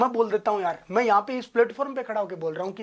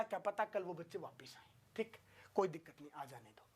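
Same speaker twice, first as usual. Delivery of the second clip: whispered aside; 3 semitones lower, 17 dB quieter than usual. Real, not acted. Teacher's private aside after class.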